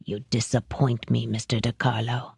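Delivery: quiet cold disappointment; low, unraised voice. A narrator's reading. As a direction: let the words carry without volume. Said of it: "Finley didn't raise his voice".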